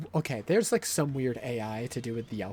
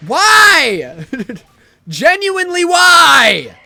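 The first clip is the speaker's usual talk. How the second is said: high shrieking yell; screaming; 12 semitones higher, 18 dB louder, much slower than usual. Real, not acted. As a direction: scream in disbelief. Screaming at game.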